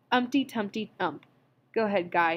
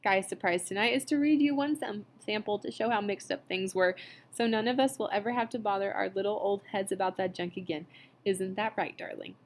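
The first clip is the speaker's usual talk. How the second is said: usual speaking voice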